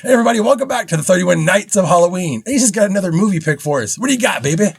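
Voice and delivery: loud, high, fast host intro; hype show intro. Acted. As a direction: kick off the show with big energy. Hosts the show opening.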